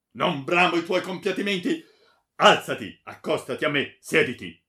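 loud, high rebuff, then orders; angry command. A narrator's reading. Baron refusing the friar's compassion, commanding. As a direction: reject pity and issue loud orders.

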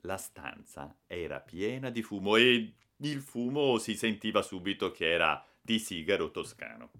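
ordinary delivery

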